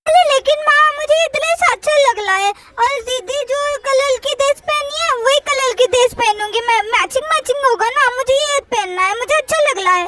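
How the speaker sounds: put-on babyish, lisped pleading; lisping little-sister voice; little girl Chutki, insisting. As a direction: insist like a small child who wants her way.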